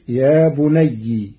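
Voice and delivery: بني with a kasra on the final ي, slowly; slow بني ending in -ni not -naya; Warsh reading. Shown as a demonstration. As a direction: say بني with a short i at the end. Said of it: "بكسر الياء".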